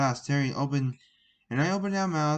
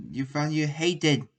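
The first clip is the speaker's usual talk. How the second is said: plain reading voice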